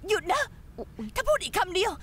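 high, furious cry of stop; furious threat. Acted. Furious woman threatens the one taunting her. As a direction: snap out a death threat in rage.

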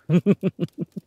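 quick, high, loud chuckle; short run of tittering chuckles; amused chuckle. Acted. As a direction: burst into a short amused laugh. Amused in flirtatious one-sided scene.

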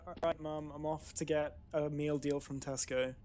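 a lightly done cockney lad; English accent. Retold or imitated. Demonstrating a British accent for friends to copy. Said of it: "impression of an Englishman".

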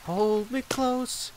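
hammed-up soft plea, slowly; mock tender plea. Pleading with Bob in a soft mock-tender voice. Begging Bob to hold him.

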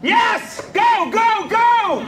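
shouted yeses, pitched high; triumphant cheering. Real, not acted. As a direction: whoop the car on as it finally goes. Cheering as car finally moves.

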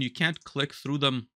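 ordinary delivery

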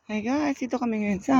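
hushed. Real, not acted. Keeping voice down near Kim.

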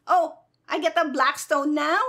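ordinary delivery

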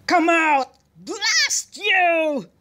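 high, loud exclamation, drawn out at the end; angry shout. A narrator's reading. General shouting at the duck in the gun, angry. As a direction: shout in fury at a stubborn creature. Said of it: "he shouted".

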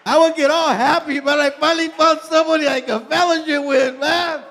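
loud, high and thrilled; happy shout of relief; excited outburst. Real, not acted. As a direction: burst out with joy.

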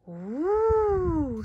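high, loud cooing ooh; little bees' ooh. A narrator's reading. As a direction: coo in wonder like tiny bees. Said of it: "said the little bees".

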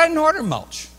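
incredulous, voice shooting high; high-pitched protest. Retold or imitated. Customer disputes mulch on receipt, high-pitched protest. Irritated customer objects to charge.

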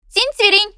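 high chirping tweet; bird chirp. Acted. Bullfinch calls from the apple tree, high chirp.

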